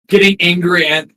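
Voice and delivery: ordinary delivery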